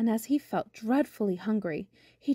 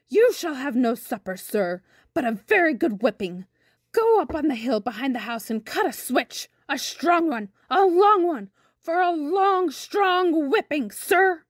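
wrathful great aunt, building higher and louder; angry scolding; 5 semitones higher, 4 dB louder than usual. A narrator's reading. Great aunt orders boy to cut a switch, angry scolding. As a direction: scold him, stretching out the key words. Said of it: "She was so angry".

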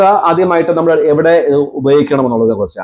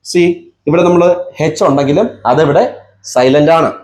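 ordinary talk

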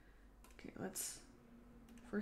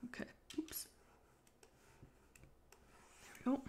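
hiccuping between words; hiccups; 7 semitones higher, 15 dB quieter, much faster than usual. Real, not acted. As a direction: talk through hiccups. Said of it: "Look at the hiccups".

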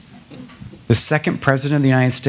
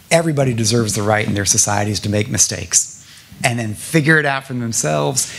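usual speaking voice

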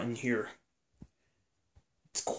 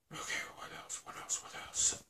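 whisper; 12 dB quieter, faster than usual. Real, not acted. Muttering while thinking.

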